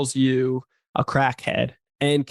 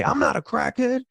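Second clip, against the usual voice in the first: much higher-pitched mock denial; mock protest; 12 semitones higher than usual. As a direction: protest the label in a raised, put-on voice. Mock protest to a name-caller.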